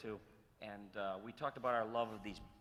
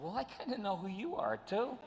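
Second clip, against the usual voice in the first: modest joke; 9 semitones higher than usual. Retold or imitated. Retold joking reply to Phil.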